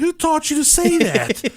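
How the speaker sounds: high, startled who taught you; a dad caught off guard; shocked dad. Retold or imitated. Father shocked at his daughter's cursing, high surprised question. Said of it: "I said".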